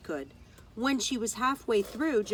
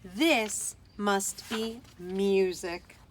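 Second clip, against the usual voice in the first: hushed awe; 2 semitones lower, much slower than usual. A narrator's reading. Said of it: "she thought".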